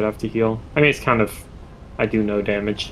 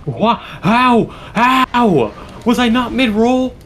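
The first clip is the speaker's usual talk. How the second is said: shocked outburst; 13 semitones higher, 5 dB louder, much slower than usual. Real, not acted. Player dies, disbelief.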